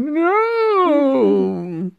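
long, high-pitched imitation scream; mock scream. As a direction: imitate a screaming victim, drawn out. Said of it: "then he's screaming like".

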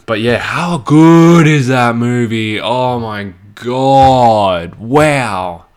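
loud, slow, drawn-out amazement; awestruck raving. Real, not acted. Podcaster gushing over The Departed, awestruck raving. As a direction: stretch the words out in loud awe.

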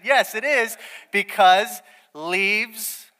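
high, loud, slow yes it is, because; drawn-out insistence. Retold or imitated. Answering his wife, a slow, loud insistent yes. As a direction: stretch each word out to press the point.

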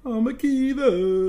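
high, loud, drawn-out cheer; hailing cheer. Pretend. Co-host greeting the listener's name, hailing cheer. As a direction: call the name out like a fan.

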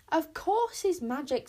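loud, high, drawn-out retort; impatient, emphatic put-down; snapping. A narrator's reading. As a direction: snap back in exasperation. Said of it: "snapped Bodkin".

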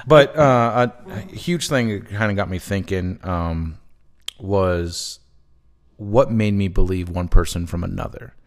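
ordinary talk, nothing marked

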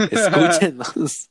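slow high begging plea; pleading. Pretend. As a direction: whine it out, slowly. Begging listeners to listen.

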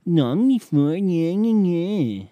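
high, loud nagging gibberish; mock a complainer with high, whiny babble; exaggerated nyah-nyah whine; mocking whiny fan. Mocks fans babbling their objection.

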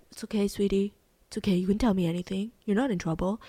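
lightly voiced soft dad, gentle and calm; a hint of a reassuring father calming a girl; soothing dad figure. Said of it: "try to be like a dad".

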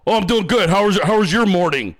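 unlightened, loud bro voice; mock masculine voice. Mimicking the worker's real reply, unlightened masculine voice. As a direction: imitate a man making no effort to sound girly. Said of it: "Bro hit me back with the".

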